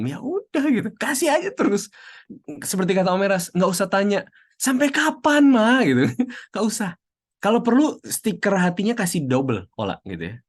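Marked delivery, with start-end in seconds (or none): 4.6-5.8 much higher, whining and louder; a teen's high-pitched "until when, Mom?"; high whiny teen. Retold or imitated. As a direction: complain to mom in a high whine.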